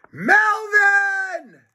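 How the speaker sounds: very high shouted outcry; shocked betrayal yell; horrified cry. Acted. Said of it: "cried George, horrified".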